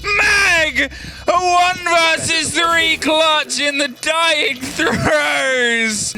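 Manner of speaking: rising from excited to a full shout; building hype shout. Real, not acted. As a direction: build the call up to a roaring shout. Caster calls a clutch play.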